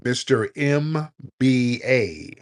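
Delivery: slow, stretched-out summons to a caller; each letter stretched long; drawn-out calling. Real, not acted. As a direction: call the name out very slowly. Calling an unresponsive caller onto the line.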